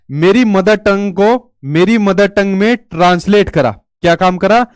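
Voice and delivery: usual speaking voice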